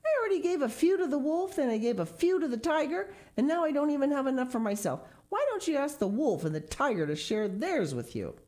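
put-on boy raging at the elephant; rage. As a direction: rattle off the grievance in a fury. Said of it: "quivered with rage".

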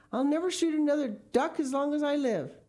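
high, loud pleading promise; frantic, high-pitched oath; desperate vow. A narrator's reading. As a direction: swear it for dear life. Mister Gregg pleading at gunpoint.

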